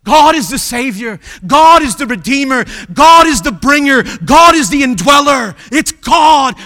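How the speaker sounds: shouted litany, each line hammered; full-voiced pulpit shout; thundering proclamation. Real, not acted. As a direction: thunder it out to the whole church. Preacher's climactic litany about God.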